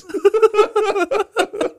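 loud, high, helpless cackling; real uncontrollable laughter; howling laughter. Real, not acted. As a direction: double over laughing. Hysterical laughter.

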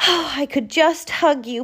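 high, gushing gratitude; beaming. Acted. Porcupine thanks her friends for their gifts, beaming. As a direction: beam with joy at friends. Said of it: "Porcupine beamed".

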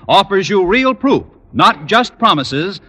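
ordinary talk

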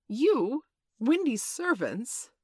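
high, loud, drawn-out disbelief; incredulous. A narrator's reading. John hears the boys are Wendy's servants, incredulous. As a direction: swoop up in astonished questioning.

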